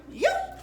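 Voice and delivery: squeaky high peacock yelp. Shown as a demonstration. Teacher shows kids a peacock yelp. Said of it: "Can you yelp like a peacock?".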